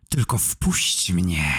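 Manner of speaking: slow hissed whisper of a vampire; whispery, lingering vampire hiss. A narrator's reading. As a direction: hiss it low and drawn out. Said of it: "wysyczał".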